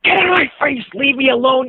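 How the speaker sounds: imitating his own angry blow-up; venomous outburst. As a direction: explode at the pestering kid. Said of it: "I exploded with just the kind of venom".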